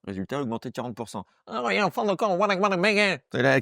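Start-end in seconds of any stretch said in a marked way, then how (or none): 1.5-3.2 high-pitched silly babble; babbling dupes. Acted. Comic mocking voters who stay confused, high babbling voice. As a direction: blither it high, words tumbling into nonsense.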